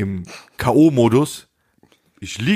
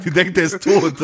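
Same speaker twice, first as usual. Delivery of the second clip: higher-pitched, through laughter; laughing; 7 semitones higher than usual. Real, not acted. Storyteller amused by imagined woman's discovery, laughing high. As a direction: crack up while saying it.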